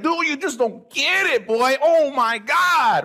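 high, drawn-out "Oh my God"; exasperated hype. Retold or imitated. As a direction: plead that they just don't get it. Frustrated at friends not getting it.

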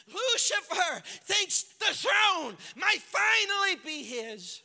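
loud, high shouted preaching; fiery pulpit shout; preaching at full force. Acted. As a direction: preach it out as hell celebrates.

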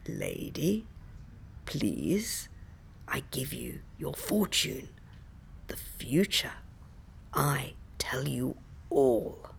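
put-on low, soft, gravelly old woman; small gravelly voice. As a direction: rasp it low and hushed like an old fortune teller. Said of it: "a small, gravelly voice addressing me".

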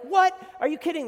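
very high loud disbelief; shrill-pitched shouted what; shocked. Retold or imitated. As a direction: yelp in shock. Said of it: "and you're like".

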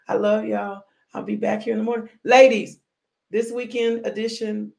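normal talking voice

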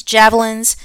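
usual speaking voice

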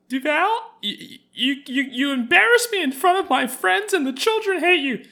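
very high, loud whiny complaint; whiny nagging wife. Pretend. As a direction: shriek like a fed-up wife.

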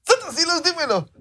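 very high, hammed-up plea; theatrical high-pitched outburst; mock exasperation. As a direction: demand it in goofy desperation. Exasperated demand in skit.

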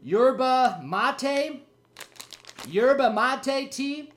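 Yerba mate sounded out very slowly as "YUR-buh mah-TAY"; unsure pronunciation. Real, not acted. Host reading a tea package, tries Yerba mate slowly. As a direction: stumble slowly through Yerba mate like a new word. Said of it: "I can't even say that first word".

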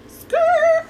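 high, loud tire-screech noise; screeching halt. Acted. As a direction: screech to a stop in disbelief. Mock screeching halt at his invitation.

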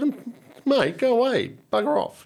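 high, loud, laughing brush-off; mock rude brush-off. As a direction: shoo him off with a laugh in the voice. Retold rude dismissal, laughing.

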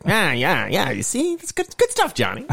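high-pitched hammed-up mobster; old-timey gangster. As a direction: approve the scheme like a cartoon gangster boss. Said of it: "the old-timey gangsters".

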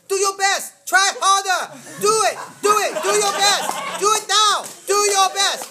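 very high, very loud imitation yell; frantic shouted coaching. Other girl urging Stephanie through birth, imitated shouting. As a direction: holler short urgent commands at full volume.

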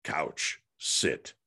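low, word-by-word machine voice; imitated talking gadget; electronic voice box. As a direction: drop low, each word on its own. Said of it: "an electronic voice box".

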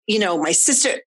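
ordinary talk, nothing marked

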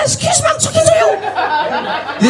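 very high, slow, stretched excuse me; high drawn-out demon call. Acted. Demon politely calling the walking comedian, very high and slow. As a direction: call him sweetly in a thin high demon voice.

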